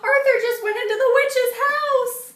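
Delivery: high, loud panicked call; alarmed shout. A narrator's reading. Buster shouts to friends in alarm, high and loud. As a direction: sound the alarm to everyone.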